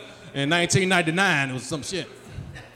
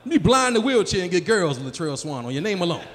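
ordinary talk

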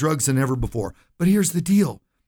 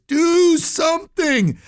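high, drawn-out shouted cry; imitated scream; 4 dB louder, much slower than usual. Host acts a voice screaming from heaven's audience, loud scream. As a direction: scream it out slowly from the crowd, pitched way up. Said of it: "comes this loud scream".

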